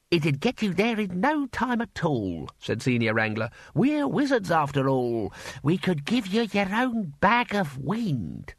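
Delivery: lightly voiced Senior Wrangler, pleased with himself; lightly voiced pompous old wizard. A narrator's reading. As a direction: offer it as if it were obvious. Senior Wrangler offers helpfully.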